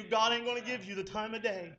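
ordinary talk, nothing marked

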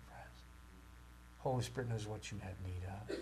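ordinary talk, nothing marked

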